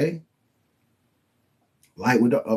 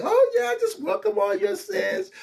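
much higher, sing-song mock welcome; mocking indulgent voice. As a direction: sweetly welcome every sin, in mockery. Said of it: "He ain't like".

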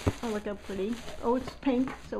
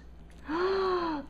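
delighted gasp; 9 semitones higher than usual. Real, not acted. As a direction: gasp at something cute. Finds a teapot charm.

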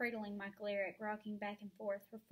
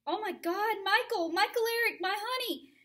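high, loud frantic cry; mother panicking over her seizing son, high and loud; 11 semitones higher, 12 dB louder than usual. Acted.

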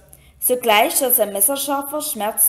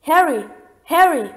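higher, louder calls from afar; distant calling voices; 5 semitones higher, 5 dB louder than usual. A narrator's reading. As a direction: voices calling his name in his head. Distant voices calling his name.

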